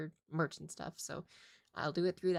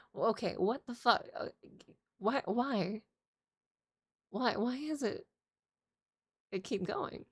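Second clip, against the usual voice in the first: louder and higher, disbelief rising; incredulous; 6 semitones higher, 4 dB louder than usual. Retold or imitated. Scammer keeps raising the fee, louder incredulous outburst. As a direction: demand why the price keeps climbing.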